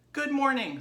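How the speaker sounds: a sweet, well-mannered little pig; polite young pig. Acted. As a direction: Babe greeting the sheep, high and polite. Babe's voice in story.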